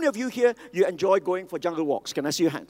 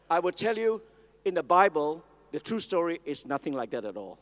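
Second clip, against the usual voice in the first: ordinary talk, nothing marked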